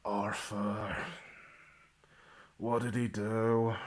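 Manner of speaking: low, soft groan of recognition; exasperated groan. Acted. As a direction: groan the name wearily. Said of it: "just groans, goes".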